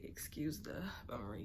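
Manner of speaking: soft unvoiced whisper of "Excuse the bummery"; whispered apology. Real, not acted. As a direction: whisper it sheepishly. Same apology repeated.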